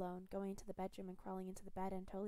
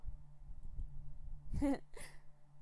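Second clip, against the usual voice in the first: breathy snicker; 7 semitones higher than usual. Acted. Captor laughing softly.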